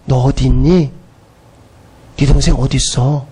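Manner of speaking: very low, subdued voice of God; gentle divine questioning. Acted. God seeks out the sinner.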